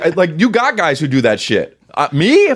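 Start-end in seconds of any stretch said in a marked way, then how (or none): 1.9-2.5 mock outrage.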